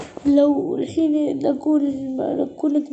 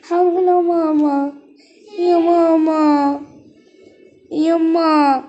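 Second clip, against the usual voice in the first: slow drawn-out call for mama, louder; 2 semitones higher, 4 dB louder, much slower than usual. Acted. Child calling mother to wake her, drawn-out loud call.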